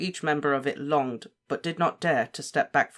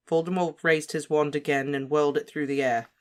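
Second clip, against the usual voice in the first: ordinary delivery, nothing marked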